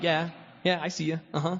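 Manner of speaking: a hammed-up street taunt; mock taunting. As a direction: sneer I see you at the drivers. Taunting passing cars.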